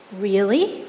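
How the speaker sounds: cautious, testing the word; careful question. A narrator's reading. Kid answers the bully, carefully. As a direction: ask it carefully, not to provoke. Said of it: "I said carefully".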